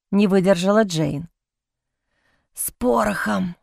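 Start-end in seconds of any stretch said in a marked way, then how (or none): 2.5-3.6 a wheezing croak, much softer; hoarse rasp. A narrator's reading. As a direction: rasp the words out, barely voiced. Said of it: "прохрипел Джозеф". (хриплый сдавленный шёпот)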